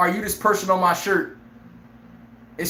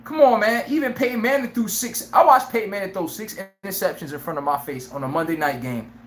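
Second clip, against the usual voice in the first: normal talking voice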